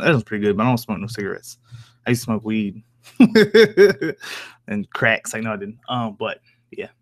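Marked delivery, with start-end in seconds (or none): 3.2-4.5 real burst of high laughter; loud high giggle. Real, not acted. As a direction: laugh at your own confession. Laughs at weed joke.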